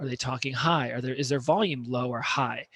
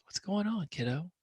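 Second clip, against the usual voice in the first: much softer, gentle check-in; 5 semitones higher, 7 dB quieter than usual. Shown as a demonstration. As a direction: ease into it quietly. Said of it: "really slow and a low tone".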